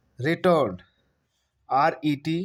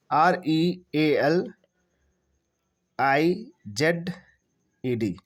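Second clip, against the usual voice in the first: slow spelling; much slower than usual. Shown as a demonstration. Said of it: "realised हो जाएगा".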